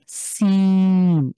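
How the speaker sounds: সিন with a long hissed s, drawn out very slowly; seen like English s. Shown as a demonstration. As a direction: hiss the s of সিন and hold it. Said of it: "ইংলিশের যে এস আছে না".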